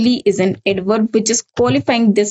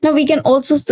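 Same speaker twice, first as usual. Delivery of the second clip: ordinary delivery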